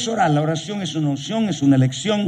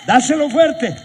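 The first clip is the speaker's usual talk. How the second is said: higher call to the church, pushed out; rallying call; 9 semitones higher, 3 dB louder than usual. Real, not acted. Preacher urging the congregation on, rallying call. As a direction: urge them on, voice up.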